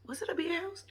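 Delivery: softly, a private question; hushed uncertain whisper; whispered aside. Real, not acted. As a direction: whisper it to yourself. Unsure aside to viewers.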